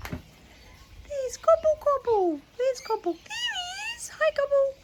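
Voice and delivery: put-on very high falsetto baby talk; playful squeaky greeting. Reader spotting Gobble joining the reading, playful falsetto greeting.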